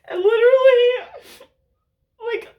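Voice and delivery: words breaking into tears; crying. Real, not acted. Crying while telling about Elijah.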